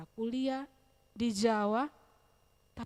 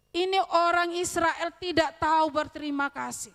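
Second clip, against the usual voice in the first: raised, louder scolding; omelan kesal; 6 semitones higher, 7 dB louder than usual. Acted. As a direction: scold them in mock indignation. Mock scolding of ungrateful Israelites. (mengomel kesal dengan suara meninggi)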